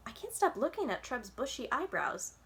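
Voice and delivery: usual speaking voice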